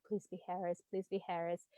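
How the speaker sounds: soft, hushed repeated plea; hopeful murmur. Retold or imitated. Vlogger recalling her silent hope for Harris, softly pleading. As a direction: whisper it like a wish under the breath. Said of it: "all I kept thinking was".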